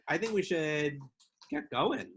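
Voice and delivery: ordinary delivery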